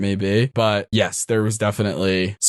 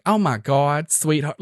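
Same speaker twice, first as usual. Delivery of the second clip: hammed-up sweetheart, pitched higher; exaggerated feminine voice; 5 semitones higher, slower than usual. Shown as a demonstration. DM shows how exaggeration helps, hammed-up gushing. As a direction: overdo the gushing femme character lightly. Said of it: "hyperbole of being like".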